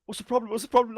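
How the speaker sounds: high-pitched imitation of a confused rescuer; clueless rescuer. Rescuer running up to stranded soldiers, mock confusion. Oblivious rescuer voiced in retelling.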